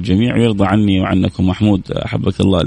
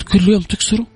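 much higher puzzled question; puzzled interviewer; 11 semitones higher than usual. Retold or imitated. Host mimics the anchor, high and baffled. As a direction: squeak out her baffled question.

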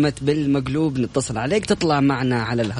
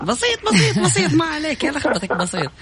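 laughing; 12 semitones higher than usual. Real, not acted. Teasing caller with laughter.

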